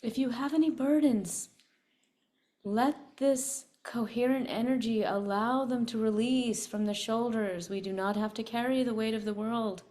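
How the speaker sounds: calm, soothing meditation voice; guided meditation. Acted. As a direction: guide the group gently into release. Leads a meditation.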